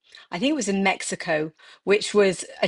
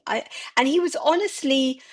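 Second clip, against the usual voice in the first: usual speaking voice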